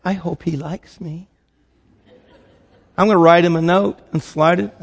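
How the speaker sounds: put-on soft, hushed girlish hope, with a laugh; twelve-year-old girl's prayer. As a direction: whisper it like a shy schoolgirl crush. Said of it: "if you're a twelve-year-old girl, you're praying".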